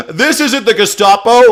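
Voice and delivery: high, loud, laughing through words. Real, not acted. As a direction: say it while still laughing. Laughs through sarcastic line.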